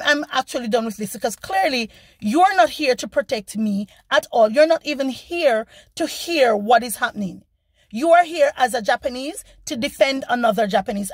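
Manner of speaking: ordinary talk, nothing marked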